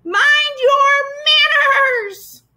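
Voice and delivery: very high, loud, drawn-out bird screech; angry scream. A narrator's reading. Disturbed bird scolding the mob, screaming angrily. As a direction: screech it slowly like an outraged bird. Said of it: "she screamed".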